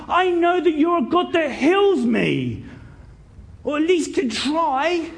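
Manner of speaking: high, insistent plea; indignant. Acted. Blind beggar demanding that Jesus heal him, indignant. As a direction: insist on it at full pitch. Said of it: "he's indignant".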